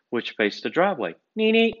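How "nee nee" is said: high two-beat horn honk; imitated car horn. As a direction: mimic a car horn beeping twice. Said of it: "We hear a horn".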